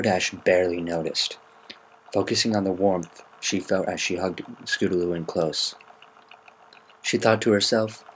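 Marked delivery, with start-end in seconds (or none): none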